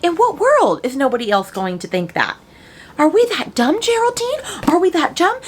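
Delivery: high, loud incredulous retort; a high, loud, outraged rhetorical question; exasperated disbelief. Retold or imitated. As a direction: ask it like they can't believe anyone's that dumb.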